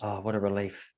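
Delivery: sarcastic relief. Acted. Sarcastic reply to investigation result.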